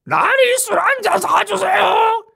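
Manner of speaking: very high, strained, slow croak; a wrecked voice after swallowing charcoal; ruined voice. Shown as a demonstration. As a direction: sound like charcoal burned your throat. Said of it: "이런 목소리를 한다".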